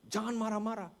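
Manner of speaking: acted-out retort to a father; scolding back. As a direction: imitate a child telling Dad off. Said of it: "Dia marahin balik bapaknya".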